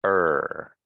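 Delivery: "er" alone, low, full and held; stressed er. Shown as a demonstration. Teacher reminding students before practice, full drawn-out stressed "er". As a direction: sustain "er" with vibration, letting it fall. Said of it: "this is the stressed".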